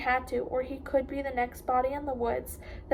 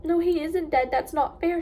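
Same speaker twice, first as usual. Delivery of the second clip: louder and higher, tearful protest; crying; 4 semitones higher, 4 dB louder than usual. A narrator's reading. Pip crying to her dad over the dead dog, louder. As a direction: cry it out through real tears. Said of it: "she cried".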